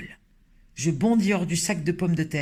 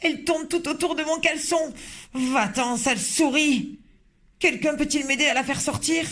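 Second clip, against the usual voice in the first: much higher, desperate pleading, slowing on sale souris; panicked pleading; 9 semitones higher than usual. Acted. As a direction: plead for someone to get it out. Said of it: "criait l'homme".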